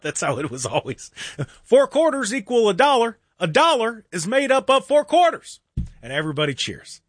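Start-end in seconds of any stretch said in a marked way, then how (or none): 1.6-5.6 higher, lofty parody of a politician rallying voters; mock stump speech. Radio host lampoons a candidate's rally speech, mock-grand. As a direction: ham up a hollow campaign speech.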